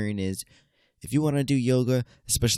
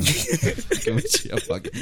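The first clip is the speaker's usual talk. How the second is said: laughing; slower than usual. Real, not acted. Teasing the listeners.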